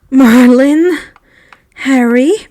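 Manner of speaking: loud, startled outcry; shocked exclamation. A narrator's reading. Friend reacting to Harry's confession, shocked. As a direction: blurt it out in shock.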